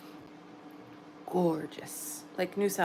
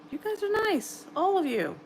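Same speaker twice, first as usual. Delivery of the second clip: much higher, gushing; delighted squeal; 10 semitones higher than usual. Real, not acted. Streamer gushing to her kind chat, high delighted squeal. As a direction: squeal it out, touched and thrilled.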